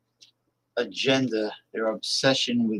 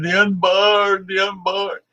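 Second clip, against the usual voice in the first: mocking old zealot; 8 semitones higher, 5 dB louder than usual. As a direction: ham up the pious old extremist. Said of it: "I'm gonna be saying the unborn".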